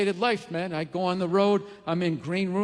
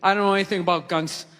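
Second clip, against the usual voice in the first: lower, sheepish honesty; 8 semitones lower than usual. Retold or imitated. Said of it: "I go".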